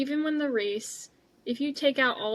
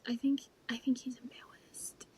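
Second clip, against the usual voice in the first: whisper; 6 dB quieter than usual. Real, not acted. Teasing aside about Oscar.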